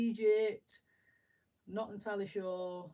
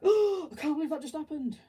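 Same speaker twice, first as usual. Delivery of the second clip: gasp, then much higher and louder gush; starstruck; 9 semitones higher, 10 dB louder than usual. Retold or imitated. Fan after the guitarist leaves, loud high gush. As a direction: overflow with disbelief and joy.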